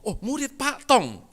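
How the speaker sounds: high, loud, drawn-out recognition; exaggerated awed realization; mock impressed surprise. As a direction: imitate someone suddenly very impressed. Acts out pastor's sudden recognition.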